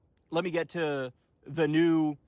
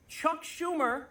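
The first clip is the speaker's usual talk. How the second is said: incredulous reveal; 11 semitones higher, much slower than usual. Acted. Reveal punchline.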